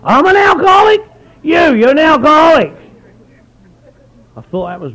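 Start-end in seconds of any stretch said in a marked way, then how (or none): 0.0-1.0 high, loud incredulous question; stunned disbelief. Retold or imitated. Newcomer hearing the word, loud shocked question. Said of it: "He said". 1.4-2.8 loud, high, flat insistence; blunt old-timer. Retold or imitated. Old-timer insisting to the newcomer, loud and blunt. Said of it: "He said".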